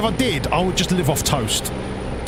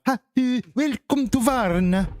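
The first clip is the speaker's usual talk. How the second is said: put-on higher, vaguely Scandinavian-sounding accent on "welcome to Varna"; mock foreign accent; 9 semitones higher than usual. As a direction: greet them in a made-up Eastern European accent. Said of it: "I don't know what a Bulgarian accent sounds like".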